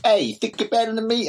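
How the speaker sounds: a hammed-up Boston actor picking a fight; lightly done Boston accent, confrontational; Boston accent tough guy. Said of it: "do my Boston accent".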